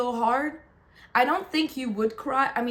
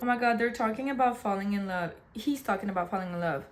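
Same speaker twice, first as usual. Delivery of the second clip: ordinary talk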